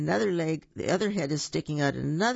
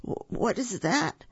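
higher-pitched, mildly fearful stutter; stuttered question; 6 semitones higher than usual. A narrator's reading. Boo sees his shadow, frightened stutter. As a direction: stumble on the first word in fright. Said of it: "Scaredy Boo stuttered".

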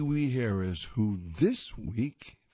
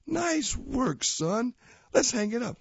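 higher, cheerful cartoon father; cheerful cartoon dad; 11 semitones higher than usual. Dad praising Tiger, higher cheerful cartoon voice. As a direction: praise your son brightly.

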